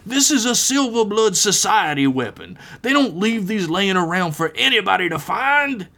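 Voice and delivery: high-pitched character voice, alarmed and insistent; high, exasperated henchman; indignant story villain. Acted. Story figure voiced, outraged over weapon.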